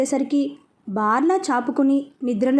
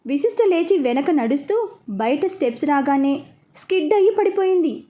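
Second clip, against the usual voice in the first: ordinary delivery, nothing marked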